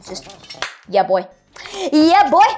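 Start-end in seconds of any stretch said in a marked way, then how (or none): 1.9-2.4 hyped cheer. Real, not acted. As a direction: hype yourself up.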